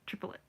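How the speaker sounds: soft "triplet" in three beats, TRIP-uh-let; three-syllable triplet. Shown as a demonstration. Showing friends the musician's count, three-syllable triplet. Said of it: "It's three syllables".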